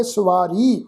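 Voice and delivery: "ਸਵਾਰੀ" with long aa and long final ee; demonstrated pronunciation. Shown as a demonstration. Teacher showing how to recite the word, careful pronunciation. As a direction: draw out the vowels of "ਸਵਾਰੀ", sa-vaa-ree. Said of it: "ਇਸ ਸ਼ਬਦ ਦਾ ਪਾਠ ਉਚਾਰਨ ਕਰਾਂਗੇ".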